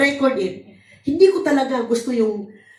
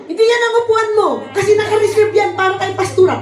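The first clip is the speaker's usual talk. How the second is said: fast, higher-pitched bossy telling-off; mock rude scolding; 5 semitones higher, faster than usual. Church member shooing newcomer from reserved seat, mock rude scolding. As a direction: rattle it off like an officious usher.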